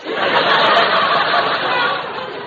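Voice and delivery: high, loud amused laugh; burst of laughter. Acted. Teacher laughs at her own grackle joke, loud and high.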